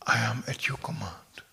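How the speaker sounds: hushed, devoted whisper; devoted dog whisper. Retold or imitated. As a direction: whisper it like a loyal dog surrendering. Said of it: "they can say".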